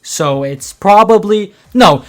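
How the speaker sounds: shouting. Real, not acted. Final emphatic conclusion of rant.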